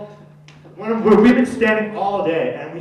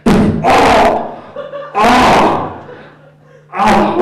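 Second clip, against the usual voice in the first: loud startled yelps; hammed-up shock; mock alarm; 3 semitones higher, 10 dB louder, much slower than usual. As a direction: panic at the pulled gun. Gunman scene, mock panic.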